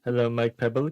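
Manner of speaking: normal talking voice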